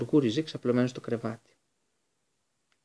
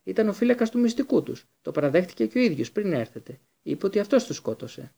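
agitated; 7 semitones higher than usual. Acted. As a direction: keep pressing the accusation hard. Harry keeps shouting accusation.

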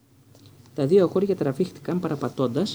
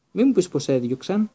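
higher, louder shocked exclamation; exclaimed; 5 semitones higher, 5 dB louder than usual. A narrator's reading. Hermione to Hagrid on hearing he is sacked, a higher, louder exclamation. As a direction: blurt it out in dismay. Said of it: "αναφώνησε η Ερμιόνη".

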